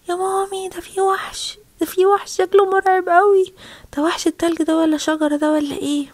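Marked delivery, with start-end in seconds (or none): 0.1-3.5 high, louder cry of alarm; alarmed child. Acted. Child warns mum of a monster, alarmed. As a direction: raise the pitch in acted fright.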